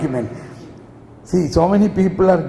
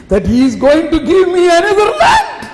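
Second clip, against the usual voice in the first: high, rising, emphatic acted proclamation; fervent announcement; 10 semitones higher, 3 dB louder than usual. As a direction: build to a very high-pitched shout. Abraham announces his departure to family.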